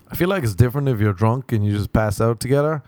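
ordinary talk

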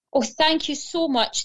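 high, drawn-out thanks; relieved cry. A narrator's reading. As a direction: exclaim slowly with a rush of relief. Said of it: "the mother duck shouted".

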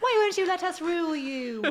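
high, hammed-up wail; playful petulant plea; mock pleading. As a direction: wail like a rejected ruler. Mocking British rulers' pleading.

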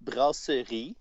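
"Brasserie" spelled out slowly by syllable. Shown as a demonstration. Brewer teaches the French name to his host, "Brasserie" slowed down. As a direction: say "Brasserie" slowly and carefully for a learner. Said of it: "I will say it slower".

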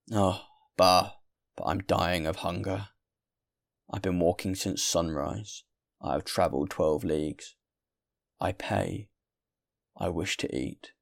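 lightly voiced, weary, insistent guest; protesting hungry traveller. Hungry traveller argues with the landlord for food, protesting.

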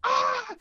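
high, loud shriek of joy, a mock yell; excited scream. Friend hears the job offer, a joyful shriek. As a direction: friend shrieks along in delight. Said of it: "And Mike went".

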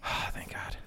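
whispered thanks; half-voiced sigh of relief; relieved whisper. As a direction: breathe it out in relief. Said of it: "I'm like".

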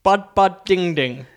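mock singsong bud bud ding ding; lightly voiced jeering friend. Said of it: "her friend next to her just went".

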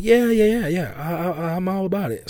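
high, eager yes-yes voice; imitating Sarah. Sarah agreeing she can take a joke, high eager mimic. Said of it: "She was like".

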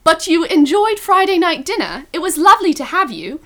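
put-on British accent. Host play-acting a transcript as Ben, mock British accent. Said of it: "British accent".

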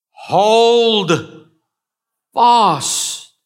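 stretched, high, loud preacher's call; emphatic preaching. Acted. Preacher urging the church to hold fast, ringing loud. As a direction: hammer each word out to the crowd. Preacher drives home the scripture.

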